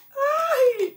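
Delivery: high-pitched yelp of effort; effortful "ay". Real, not acted. Vlogger struggling to hold the heavy box, high yelp. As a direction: yelp while struggling with a load. Es que es complicado agarrar la caja.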